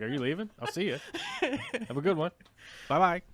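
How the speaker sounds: teasing farewell, laughing through it; high, laughing singsong goodbye; laughing mock goodbye. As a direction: pretend the cohost is walking out. Mock farewell to standing cohost.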